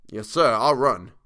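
Cockney servant, drawn out; Cockney dialect. A narrator's reading. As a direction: own up emphatically in Cockney. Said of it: "Thomas's Cockney dialect".

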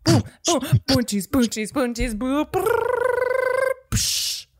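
playful nonsense beatbox with trill; vocal drum noises for a rap; mock beatboxing. Beatboxing for proposed rap song.

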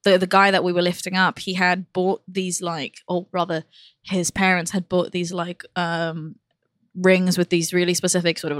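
ordinary delivery

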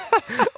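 a short, very high burst of laughter. Real, not acted. Laughing at own story. (kort, meget højt grin)

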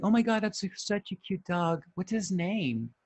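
put-on gushing girl. Girl meeting his dog on a walk, gushing. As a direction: lightly mimic a girl cooing over a dog. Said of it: "she's like".

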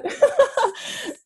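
long high laugh at her own joke; amused laugh. Real, not acted. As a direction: laugh freely at the pun. Laughs again.

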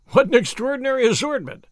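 high, loud amazed exclamation; astonished at the odd list; bursting out in surprise; exclaiming. A narrator's reading. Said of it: "I exclaimed".

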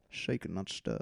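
low, soft Scottish spy voice; Sean Connery. Acted. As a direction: murmur it like a suave movie spy. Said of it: "I think I can do Sean".

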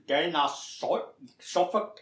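mock Northern Irish attempt at Suffolk, slowed and drawn out; demented-pirate Suffolk accent. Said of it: "sounded like a sort of demented pirate".

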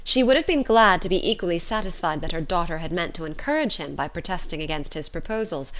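plain reading voice

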